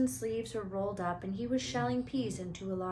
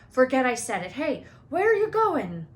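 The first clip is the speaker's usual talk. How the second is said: put-on louder, alarmed call after the kids; calling after them; 5 semitones higher, 11 dB louder than usual. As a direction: shout after them as they leave.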